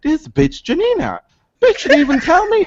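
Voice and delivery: mock outrage. Host retelling finding out about a friend's shirt, high mock outrage. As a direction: squeal it in fake betrayal. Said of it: "I was like".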